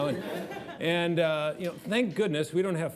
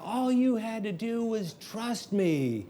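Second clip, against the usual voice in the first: higher, slow, exasperated mock yell; retold shout; 6 semitones higher, slower than usual. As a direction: drag it out in frustration. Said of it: "And then I shouted out".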